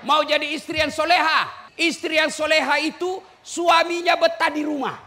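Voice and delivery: loud, high preacher's call; high-pitched, forceful exhortation. Real, not acted. Calls out to rouse the women.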